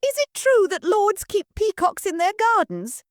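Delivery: put-on high, loud curious girl voice; excited daughter quizzing the lord; eager girl. Marianne asks lord.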